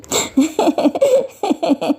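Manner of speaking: high, light character giggle; playful giggle. Acted. Daughter jokes to her mother about prasad, playful giggle. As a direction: giggle like an amused child. Child laughs after teasing mother.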